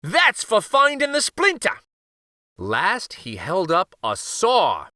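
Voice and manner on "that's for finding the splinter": put-on high, playful old-uncle voice; teasing uncle. A narrator's reading. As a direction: tease him with a high sly voice.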